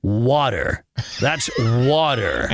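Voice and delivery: low, drawn-out American "water"; American voice, pitch dropped; American accent. Shown as a demonstration. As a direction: put on a slow American accent. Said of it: "do it in an American accent".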